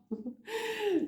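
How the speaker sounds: a sudden high squeal of a laugh. Real, not acted. Teacher laughing at the student over a tricky exception, high laugh.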